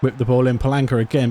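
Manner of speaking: ordinary delivery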